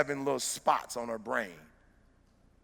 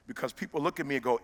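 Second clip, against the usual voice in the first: ordinary talk, nothing marked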